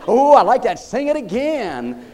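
laughing, loud, then drawn-out slow request; delighted Jesus. Retold or imitated. Preacher voicing Jesus asking for another song, delighted. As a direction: savour the song like a delighted listener. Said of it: "He'll say".

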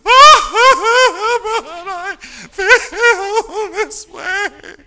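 loud, high hammed-up wail stretched out slowly; mock wailing. Preacher mocking a counselee who dissolves in tears, loud mock wail. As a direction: wail the words like a blubbering child.

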